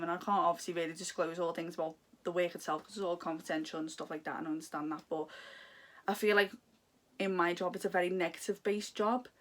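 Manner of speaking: ordinary talk, nothing marked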